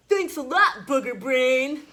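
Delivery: mock brother taunt; cheeky brother. A narrator's reading. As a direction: tease like a bratty sibling. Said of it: "My brother said".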